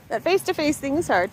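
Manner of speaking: ordinary talk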